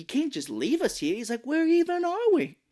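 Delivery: imitating a baffled, whiny complainer; mock panicked protest. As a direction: plead in overblown disbelief. Mark confronting the conductors.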